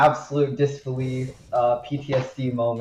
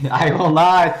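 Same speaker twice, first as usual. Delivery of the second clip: laughing refusal; 5 semitones higher, 8 dB louder, slower than usual. Real, not acted. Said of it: "laughing refusal".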